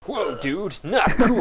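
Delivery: high loud surfer-dude outburst. Pretend. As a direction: throw it out like a stoked surfer. Mock surfer outrage.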